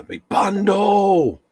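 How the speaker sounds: high, drawn-out mock yell; slow, high-pitched playful holler; playful rallying cry. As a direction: call out "bundle" long and high, in play. Mock battle cry for group hug.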